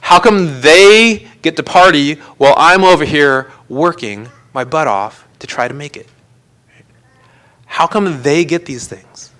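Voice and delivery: petulant mock protest, why them and not me; jealous complaining to God. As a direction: complain like someone cheated of a reward. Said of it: "we're like".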